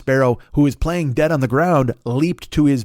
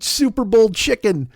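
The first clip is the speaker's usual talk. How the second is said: amused; 6 semitones higher, slower than usual. Acted. Repeating the phrase in amusement.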